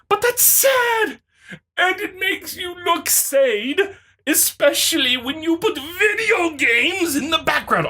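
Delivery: mocking the opponent. Quoting the opponent's jab in a high sneering mock. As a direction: sneer it like the commenter.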